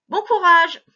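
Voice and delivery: loud, bright send-off; loud encouraging Bon courage; cheering. Real, not acted. Cheering the pupil on.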